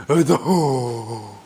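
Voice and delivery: slow, drawn-out giant's "ho"; hammed-up giant guffaw; Goliath's laugh. Storyteller imitating Goliath mocking the hiding Israelites, slow giant laugh. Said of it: "Goliath is going ... just like that".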